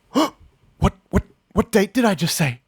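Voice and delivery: high, alarmed whisper of dawning panic; suddenly realizing the slip; panicked realization. Retold or imitated. Acted panicked realization quote.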